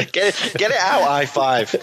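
high-pitched jeer through laughter; laughing. Real, not acted. Joking with mates about High Five, laughing. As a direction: crack up while egging him on. Laughing at his own crude joke.

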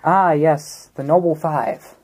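ordinary talk, nothing marked

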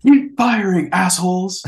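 loud high shouted quote; movie quote shout. Retold or imitated. Quoting the movie to a friend, shouted high. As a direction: bellow the order at the gunners.

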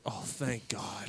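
soft, slow breathy whisper of relief; relieved whisper. Retold or imitated. Finally spotting his car, a slow relieved whisper. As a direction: breathe it out like a weight lifted.

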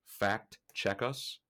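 slow, drawn-out repeat with a rise. Shown as a demonstration. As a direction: stretch each word out slowly.

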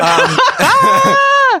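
high, loud burst of laughter; laughing shout. Real, not acted.

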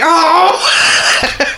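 a sudden high laughing yelp; laughing cry out. Retold or imitated. Recalling the kids' line to her co-host, high and laughing. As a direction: let it burst out as a laugh. Retelling being moved by scene.

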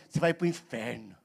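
voz grave, baixa e arrastada de condenação; igreja condenadora. Acted. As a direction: imitate a doom-saying church, low and ominous. Igreja imitada ameaçando o pecador. (voz sombria de condenação, imitando)

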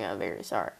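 deep mock-serious apology; very low deadpan. Shown as a demonstration. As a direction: drop to a low, flat apology. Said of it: "deadpan low repeat".

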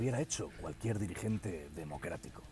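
mock him as a self-satisfied fool; imitating a pompous dimwit; idiot's voice. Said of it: "Lo imita bien".

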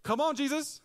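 high, loud impatient plea; desperate plea. Retold or imitated. Father urging Jesus on, high impatient plea. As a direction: hurry him along, exasperated.

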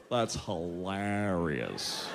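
low, very slow, lazy hilarious; slack dude drawl. Retold or imitated. Comic mimicking the guy saying hilarious, low and slow. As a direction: drag it out like a bored dude. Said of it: "And he goes".

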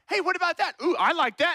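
put-on high pleading kid voice, lightly done; mock a child pointing at toys; kids begging.

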